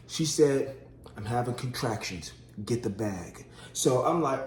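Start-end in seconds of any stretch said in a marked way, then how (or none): 1.2-3.5 low, soft retold wake-up; quiet, lower-pitched quote; hushed wife in labour. Wife waking husband in labour.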